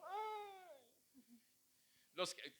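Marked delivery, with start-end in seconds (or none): 0.0-0.8 mock pained sigh. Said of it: "lo exhortaba así como".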